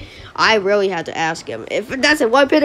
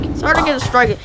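ordinary delivery

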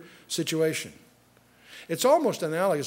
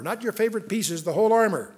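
ordinary delivery

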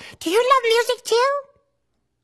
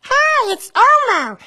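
Elmo voice. Acted. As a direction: speak as cheerful Elmo to a child. Elmo's voice throughout.

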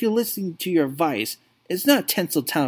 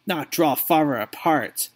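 ordinary talk, nothing marked

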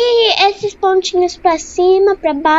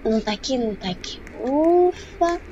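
hushed, tense hiding; being chased; 5 semitones lower, 13 dB quieter than usual. Real, not acted. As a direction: keep it low, hiding from a monster. Hiding from Slenderman.

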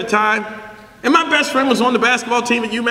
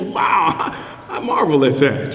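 God marveling; 9 semitones lower, slower than usual. Retold or imitated. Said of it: "he sits and goes".

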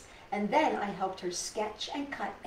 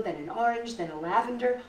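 usual speaking voice

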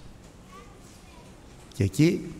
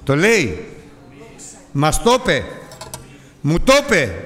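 louder, higher, hammering each phrase; emphatic insistence; 5 semitones higher, 5 dB louder than usual. Real, not acted. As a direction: drive the point home. Preacher insists on the promise. (έντονη επιμονή)

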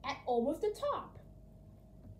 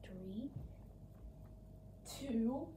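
spooky countdown; 9 dB quieter, much slower than usual. Acted. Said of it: "I'm going to scare you all".

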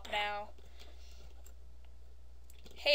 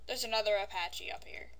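ordinary delivery

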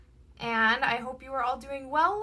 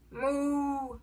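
softer, drawn-out moo; cow moo; 5 dB quieter than usual. A narrator's reading. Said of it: "He can sound like a cow".

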